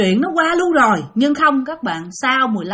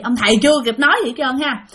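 ordinary talk

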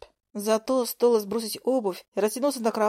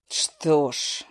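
through gritted teeth; 7 semitones lower than usual. A narrator's reading. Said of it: "сквозь зубы проговорил Зак".

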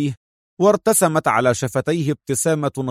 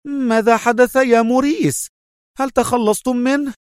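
high-pitched urgent woman, mildly shouted; urgent cry; 11 semitones higher than usual. A narrator's reading. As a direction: demand news in a very high voice. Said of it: "على أذنها صارخة". (صرخة نسائية ملهوفة مرتفعة النغمة)

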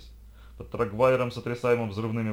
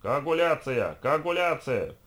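high, raised mob chant; yelling in unison; 6 semitones higher, 4 dB louder, slower than usual. A narrator's reading. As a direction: bawl it like a whole crowd. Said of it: "Все как один орали".

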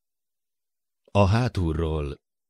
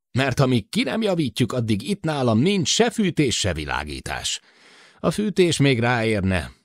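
put-on higher, worried older man over the intercom; Matt's voice; 4 semitones higher than usual. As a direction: voice Matt, anxious about the short circuit. Matt's voice continues. (Matt aggódó hangja)

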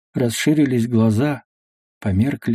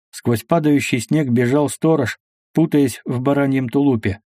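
usual speaking voice

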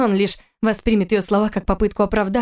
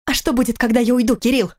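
higher-pitched, mild outcry; crying out; 4 semitones higher than usual. A narrator's reading. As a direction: cry out in protest without full volume. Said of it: "закричала она". (приглушённый крик протеста)

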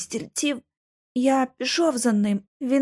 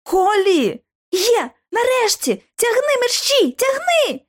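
very high, frantic cries; urgent calling out; 12 semitones higher, 4 dB louder, slower than usual. A narrator's reading. Girls cry to pull the boy free, frantic shouting. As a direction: call out high, pushing to the limit.

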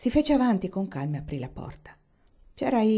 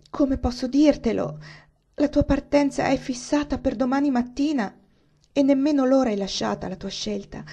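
Eleanor anguished; 6 semitones higher, 5 dB louder than usual. A narrator's reading. Eleanor tells Catherine she must leave at dawn, louder. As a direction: force out the painful news. Eleanor delivers harsh news.

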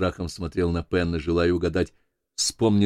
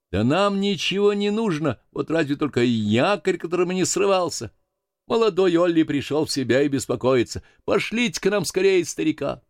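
high-pitched sailor's hail; voice from schooner; 10 semitones higher than usual. Acted. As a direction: call across to the other crew, voice raised. (высокий голос моряка со шхуны)

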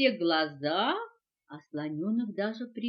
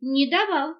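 loud, higher, firm backing; decisive confirmation; 4 semitones higher, 10 dB louder than usual. A narrator's reading. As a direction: back it up emphatically. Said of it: "решительно подтвердил попугай". (решительно)

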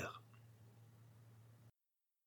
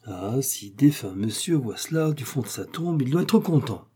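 ordinary delivery

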